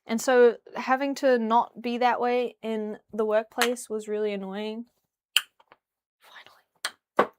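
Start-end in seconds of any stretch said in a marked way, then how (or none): 6.2-7.3 slow relieved whisper. Real, not acted. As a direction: breathe it out in a whisper. Whispered aside.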